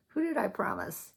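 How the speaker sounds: usual speaking voice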